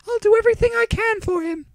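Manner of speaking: high formal doctor's question; female doctor voice. A narrator's reading.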